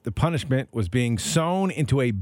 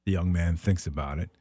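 normal talking voice

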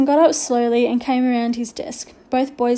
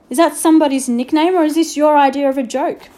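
raised voice; 4 semitones higher, 7 dB louder than usual. A narrator's reading. Headmaster demanding, raised voice.

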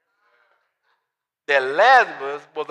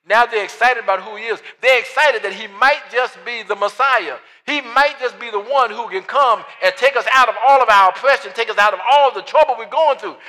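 rising louder and higher each line; building sermon; 5 semitones higher, 7 dB louder than usual. Acted. Preacher stirring the church toward Hosanna, building preaching. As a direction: climb toward a shout of praise.

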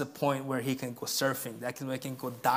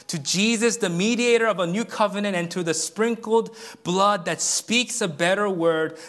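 louder, higher declaration; stirring pulpit proclamation; preaching at full force; 5 semitones higher, 6 dB louder than usual. Acted. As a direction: proclaim it with rising fervour.

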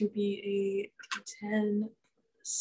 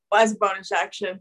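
ordinary talk

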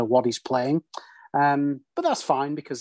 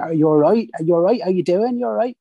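rising, chirpy Norfolk greeting, a bit higher and louder; Norfolk accent; 5 semitones higher, 4 dB louder than usual. Retold or imitated. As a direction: imitate a friendly Norfolk local. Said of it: "So I know how they speak there".

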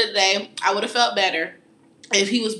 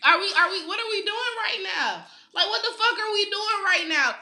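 exasperated retold rant; 7 semitones higher than usual. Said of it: "So I'm just like".

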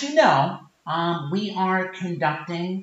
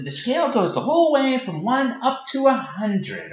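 ordinary delivery

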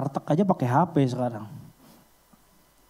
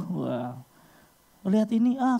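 usual speaking voice

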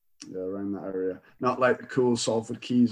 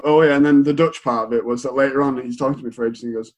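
usual speaking voice

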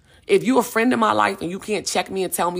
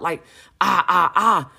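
mock stressed wail; 3 dB louder, slower than usual. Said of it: "stressing myself out".

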